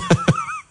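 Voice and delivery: a short high squeak of a laugh; squeaky giggle. Real, not acted. Radio host giggling at the army joke email, high squeak. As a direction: let a tiny high giggle escape.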